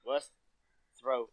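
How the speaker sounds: ordinary talk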